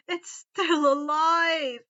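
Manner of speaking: crying shriek, slow and loud. A narrator's reading. Harry shrieks at the elves, loud and crying. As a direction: shriek it in horror. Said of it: "with a loud shriek".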